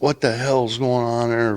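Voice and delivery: retold angry cussing. Furious roadmaster shows up at the job, retold angry cussing. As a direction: imitate a furious boss at half volume. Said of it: "Fucking cussing and screaming".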